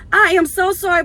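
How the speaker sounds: put-on high, loud apologetic old lady; imitating the elderly lady; elderly lady apology. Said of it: "she was like".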